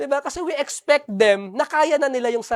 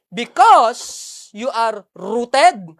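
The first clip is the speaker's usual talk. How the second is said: slow, loud, drawn-out declaration; sermon climax declared slowly and loud; preaching emphasis; 6 dB louder, much slower than usual. Acted. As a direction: preach it, stretching each word. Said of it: "slowed preaching climax".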